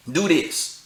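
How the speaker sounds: ordinary delivery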